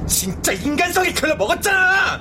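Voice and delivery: high, indignant yell; angry outburst. Acted. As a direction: spit out the insult. Quarrel continues.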